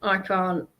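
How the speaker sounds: normal talking voice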